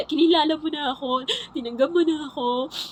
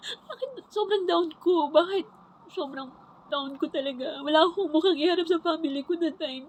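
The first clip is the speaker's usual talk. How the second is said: higher, tearful, pleading with God; crying prayer; 4 semitones higher than usual. Real, not acted. Retelling her prayer to God, crying. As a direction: ask God why through tears. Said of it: "di ko naman pigilin yung luha ko".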